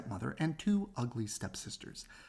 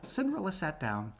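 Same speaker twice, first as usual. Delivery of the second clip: usual speaking voice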